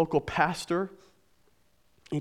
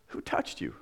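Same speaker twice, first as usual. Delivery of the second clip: lower, softer, whispered echo; incredulous whisper; 10 dB quieter than usual. Retold or imitated. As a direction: hush it out in disbelief. Preacher echoes the question.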